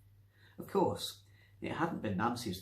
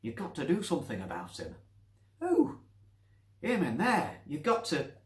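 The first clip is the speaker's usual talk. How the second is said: put-on thick Manchester accent; 6 semitones higher, 5 dB louder than usual. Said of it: "a thick Manchester accent".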